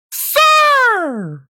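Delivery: high, loud drawn-out shout of an outraged lady; imitated high-pitched yell. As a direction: yell it like a scandalized stranger, imitated. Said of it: "yelling at me".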